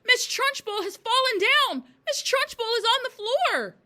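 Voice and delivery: very high, loud scream. A narrator's reading. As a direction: shriek the news to the whole class.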